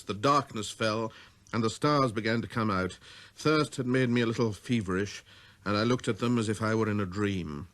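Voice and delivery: ordinary delivery, nothing marked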